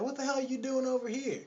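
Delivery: high-pitched mock scolding woman. Lady confronts the man over here, high mock scolding. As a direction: imitate a woman demanding an explanation.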